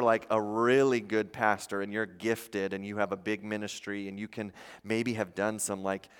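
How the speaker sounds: normal talking voice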